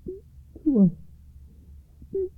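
high, broken-up plea; begging. A narrator's reading. Sons begging their motionless mother, high halting plea. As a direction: beg in short high phrases with long gaps. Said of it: "they begged".

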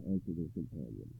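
plain reading voice

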